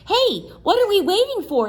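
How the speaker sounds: high, fast and eager; eager question. Acted. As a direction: ask it bubbling with curiosity. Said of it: "asked Sammy".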